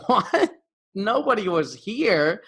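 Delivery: high, slow, stunned repeat of the excuse; incredulous. Retold or imitated. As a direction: echo her excuse in disbelief. Reacting to lame excuse.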